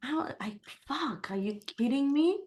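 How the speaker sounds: imitating her own fed-up swearing in the car; exasperated outburst. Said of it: "but you say it like".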